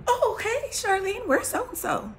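high, loud call-out to an acquaintance; mock loud, high-pitched hello; imitated nosy greeter. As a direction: pipe up high and loud, imitating someone asking after a friend. Voicing people greeting her.

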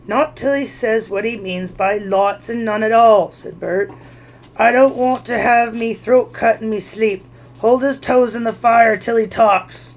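loud, harsh troll order; a troll voice used to being obeyed; threatening troll. Acted. Bert to William, a loud harsh troll threat.

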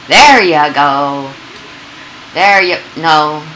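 drawn-out, slow encouragement; dad slowly encouraging his son to climb; slow coaxing. Acted. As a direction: coax it out slowly like to a toddler.

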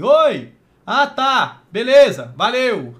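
loud call to someone far off. Retold or imitated. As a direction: call out across the room, brightly. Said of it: "como se estivesse falando com alguém lá longe".

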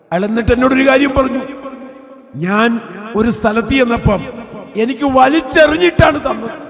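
preacher telling the poor man's humiliation at full force; fervent preaching. Acted. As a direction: make the hall feel his shame.

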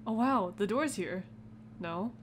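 ordinary delivery

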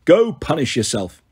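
plain reading voice

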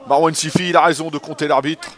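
usual speaking voice

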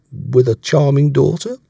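playful, pointed finish; smiling. A narrator's reading. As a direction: say it with a sly smile. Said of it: "I replied with a smile".

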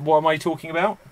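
usual speaking voice

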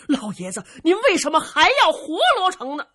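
loud, high-pitched protesting question; indignant protest. A narrator's reading. Dongfang Bo questioning the old lord's mercy, indignant protest. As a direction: challenge the old man loudly.

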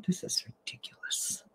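breathy exasperated whisper; whispered complaint. Real, not acted. Vlogger whispering to herself, fed up with YouTube. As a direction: mutter it under your breath in disbelief.

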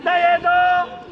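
high, stretched sideline shout; calling out. Real, not acted. Coach urging a player to see his teammate, high shout. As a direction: throw the voice high across the pitch.

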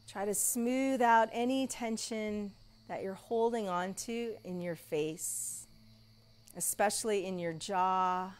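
soft, slow guided meditation voice; soft spa whisper. Acted. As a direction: lull them into stillness. Guided relaxation in Shavasana.

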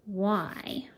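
ordinary delivery, nothing marked

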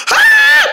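piercing scream of pain; high pained scream. Acted. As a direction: shriek as you're hit. Knocked down in fight.

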